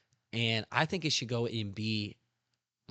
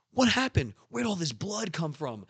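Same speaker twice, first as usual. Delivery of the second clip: alarmed Sanji; 7 semitones higher than usual. Retold or imitated. Sanji shocked at finding his bloodied crewmate. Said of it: "And Sanji's like".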